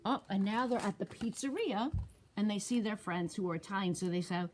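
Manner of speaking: ordinary delivery, nothing marked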